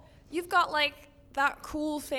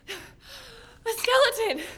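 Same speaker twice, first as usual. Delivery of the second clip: high, loud, drawn-out gasped exclamation; terrified gasp; 9 semitones higher, 5 dB louder, slower than usual. Acted. Girl naming what climbed out, terrified cry. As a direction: cry it out slowly in horror.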